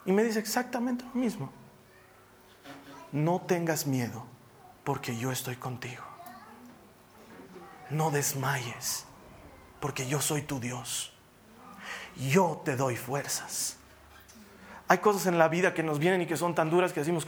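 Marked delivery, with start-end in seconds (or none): 3.1-13.8 slow, low, solemn reassurance; soft and measured, pausing between phrases; God speaking to the heart. Retold or imitated. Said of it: "Dios me habla a mi corazón".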